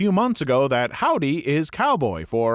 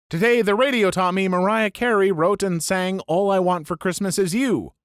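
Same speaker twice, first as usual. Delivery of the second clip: ordinary talk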